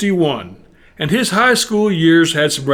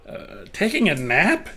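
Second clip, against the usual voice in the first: higher, questioning caller's voice; puzzled caller; 5 semitones higher, 4 dB quieter than usual. Retold or imitated. Caller hears Robert is napping, puzzled higher-pitched question. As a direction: echo it back as a baffled question. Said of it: "The voice says".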